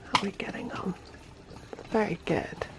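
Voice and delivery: soft unvoiced whisper. Real, not acted. Checking in, whispering by the baby.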